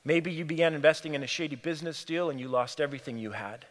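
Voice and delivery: ordinary delivery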